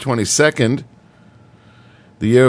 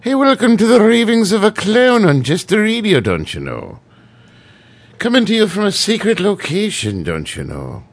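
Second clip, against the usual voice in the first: put-on Irish accent; 9 semitones higher than usual. Radio host welcoming listeners in a hammed-up Irish accent. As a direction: put on a hammy, high-pitched Irish accent. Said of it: "the special phrase with the Irish people is".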